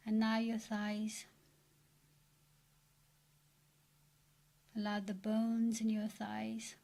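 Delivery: soft, even meditation voice with long pauses; guided meditation. Acted. Meditation leader relaxing listeners from the feet upward, soft and soothing. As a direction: guide them into relaxation, hushed and unhurried.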